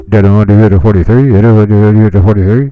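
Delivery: booming, distorted mic call; mic held close. Shown as a demonstration. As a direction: hold the mic right up to your mouth. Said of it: "You know, do it like that".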